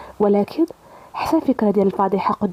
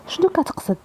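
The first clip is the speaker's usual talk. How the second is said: much higher-pitched anxious question; afraid; 9 semitones higher than usual. A narrator's reading. Reem questioning Karim about Salim's hidden truth, afraid. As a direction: ask it with a touch of fear, pitch jumping up. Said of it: "حست بالخوف".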